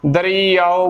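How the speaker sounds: ਦਰਿਆਓ in full, "da-ree-yaa-o"; correct pronunciation. Shown as a demonstration. Teacher showing every vowel sign, careful pronunciation. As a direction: sound the final o of ਦਰਿਆਓ. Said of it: "ਬੋਲਣਾ ਹੈ".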